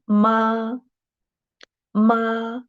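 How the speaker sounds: "Ma" held high and level; first tone. Shown as a demonstration. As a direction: sustain "Ma" on one high note. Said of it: "the highest and flat".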